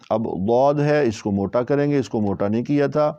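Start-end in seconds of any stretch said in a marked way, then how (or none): none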